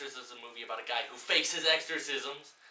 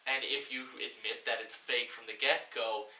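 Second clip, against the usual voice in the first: normal talking voice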